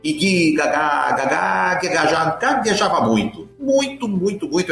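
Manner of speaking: stammering on repeated syllables; mock stutter, repeating ga-ga. Shown as a demonstration. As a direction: get stuck on the first sounds, then push through. Demonstrates his old stutter.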